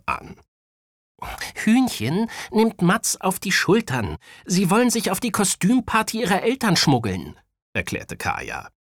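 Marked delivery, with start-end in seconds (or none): 1.5-7.4 put-on high, animated child character; boy explaining scheme. A narrator's reading. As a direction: explain the friends' scheme as a kid. Voices Kaya explaining to cousin. (hohe, erklärende Jungenstimme)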